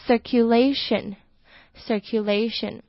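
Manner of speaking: circulation said slowly, sir then Q. Shown as a demonstration. Teacher introduces circulation to learners, slowly. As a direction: take circulation apart slowly. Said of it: "C I R发sir的音".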